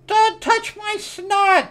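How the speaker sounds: very high, slow creature squeal. Retold or imitated. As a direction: stretch it out like a tiny squealing monster. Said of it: "She got, like, snot going on".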